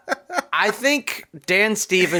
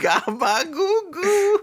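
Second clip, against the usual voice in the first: put-on baby talk; 13 semitones higher, slower than usual. Baby first word joke.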